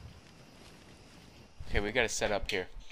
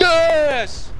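high, loud victory yell; triumphant yell; 10 dB louder than usual. Real, not acted. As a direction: shout it out in triumph. Nuke hits target.

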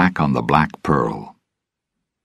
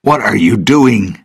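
higher, mildly angry question; 5 semitones higher, 4 dB louder than usual. A narrator's reading. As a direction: demand an answer with some annoyance. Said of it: "Gibbs asked angrily".

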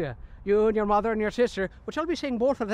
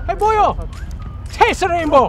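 loud, higher Irish leprechaun bellow; yelling leprechaun; 4 semitones higher, 11 dB louder than usual. Acted. Leprechaun hollering at a fleeing stranger, loud stage-Irish yell. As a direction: shout it like a crazed leprechaun.

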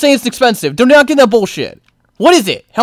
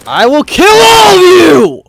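long, high, loud battle yell; furious full-voice shout; enraged threat; 6 semitones higher, 6 dB louder than usual. Real, not acted. Threatens game enemies.

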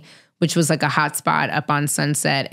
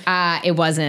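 ordinary delivery

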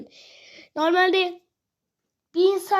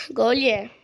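ordinary talk

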